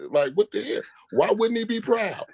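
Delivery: ordinary talk, nothing marked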